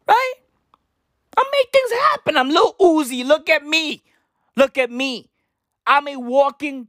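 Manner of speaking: high-pitched mock arrogant boasting. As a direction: brag at a high, excited pitch, hammed up. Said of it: "[name]'s arrogance is wild".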